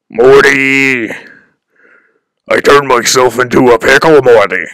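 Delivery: loud, stretched cartoon-scientist shout. Streamer answering own sidekick impression, loud cartoon scientist. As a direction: proclaim it to your sidekick in a put-on voice.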